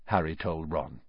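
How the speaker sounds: ordinary delivery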